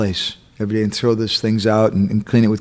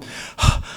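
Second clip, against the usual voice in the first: unvoiced acted exhale of relief; storyteller's relieved puff; sigh of relief; 5 dB quieter than usual. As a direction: let out a big breath, safe home. Son arrives home after escape.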